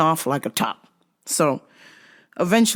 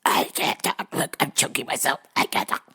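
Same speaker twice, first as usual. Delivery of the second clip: strangled, much softer croak; mock choked voice; 5 semitones lower, 6 dB quieter than usual. Grappler showing how someone in a choke sounds, faked strangled voice. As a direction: squeeze the words out as if being choked. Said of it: "if you're choking somebody, they shouldn't be able to talk".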